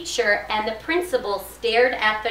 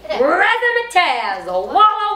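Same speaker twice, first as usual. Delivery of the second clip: high, loud, stretched-out flourish; showy exclamation; 7 semitones higher, 6 dB louder, much slower than usual. A narrator's reading. Teacher reads rhyming words to children, exuberant. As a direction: belt out each word with relish.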